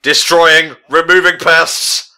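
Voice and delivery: slow, loud, high robotic voice. Acted. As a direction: announce it like a killer machine.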